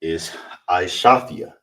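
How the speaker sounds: Aishathia said slowly, syllable by syllable; Hebrew word Aishathia. Shown as a demonstration. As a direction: sound out Aishathia carefully. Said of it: "how you would say that in the Hebrew".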